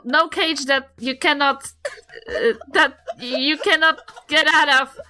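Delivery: ordinary talk, nothing marked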